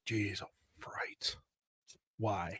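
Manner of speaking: quiet weary jeez, half whispered; hushed exasperated sigh. Retold or imitated. A father muttering at a week gone wrong, hushed. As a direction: exhale it under your breath, worn out. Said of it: "I'm like".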